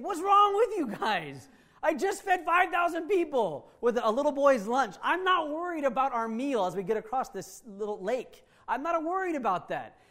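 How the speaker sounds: Jesus scolding his worried disciples, exasperated. Acted. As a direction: shake them awake with frustration. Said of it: "he's saying".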